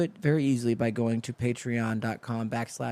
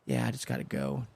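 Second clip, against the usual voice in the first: much lower, softer, tired mumble; mumbled low reply; 12 semitones lower, 7 dB quieter than usual. Retold or imitated. Tired James mumbling that he has to leave, low and soft. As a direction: drop low and mumble like a drained man. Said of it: "And just".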